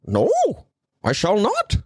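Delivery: high, haughty refusal; Jordan Peterson. Acted. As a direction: primly refuse to budge. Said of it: "Jordan Peterson kept trying to fucking steal my show".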